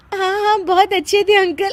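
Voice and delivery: giggling through the words; trying to console but can't stop laughing; giggling while consoling the widow. Acted. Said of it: "तुम हंस क्यों रही हो".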